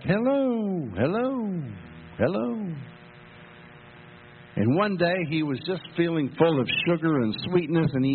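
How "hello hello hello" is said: echo. Retold or imitated. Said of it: "the mountains shouted back".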